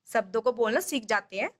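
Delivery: ordinary talk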